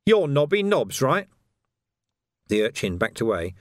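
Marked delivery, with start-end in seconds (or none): 0.1-1.3 louder, pointed accusation; accusing copper. Acted. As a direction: nail him with the name. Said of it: "pointed an accusing finger".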